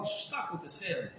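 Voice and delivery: a quiet inner check, almost under the breath; soft self-question. Shown as a demonstration. Therapist models asking herself softly. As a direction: ask it softly, as if to yourself.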